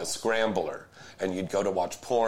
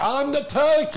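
put-on Yiddish accent; 7 dB louder than usual. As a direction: squeal it like a Yiddish grandma.

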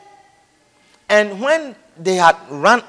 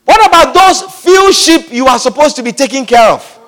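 mocking elder brother voice, louder; scornful brother; 4 semitones higher, 4 dB louder than usual. Eliab scorning David's sheep, mocking louder voice. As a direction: sneer it at the little brother. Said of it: "he demanded".

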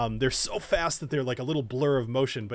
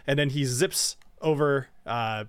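ordinary delivery

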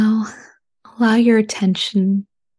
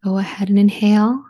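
ordinary talk

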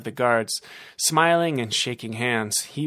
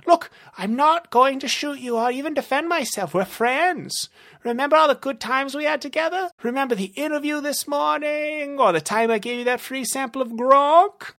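high, desperate plea; villain begging as a friend; pleading villain. Acted. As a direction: talk the monster down in a panic. Narrator voices Rumble pleading.

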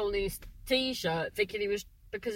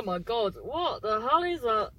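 ordinary delivery

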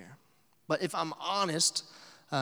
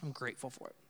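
mild grateful mumble; 2 semitones lower, 12 dB quieter, faster than usual. Retold or imitated. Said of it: "that's his words".

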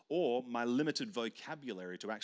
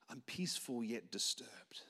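slow deadpan whisper; whispered reply; 5 dB quieter, slower than usual. Retold or imitated. Young [name] answering the pastor's wife, slow deadpan whisper. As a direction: breathe it out flat and slow.

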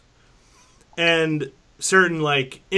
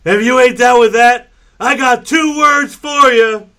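wrestling promo shout; 8 semitones higher, 12 dB louder than usual. Acted. Wrestling catchphrase finishing the intro.

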